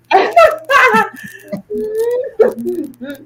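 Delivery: high, loud crying laugh; cracking up at the joke; shrieking laugh. Real, not acted. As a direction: howl with laughter.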